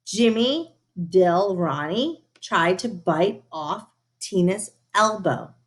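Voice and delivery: usual speaking voice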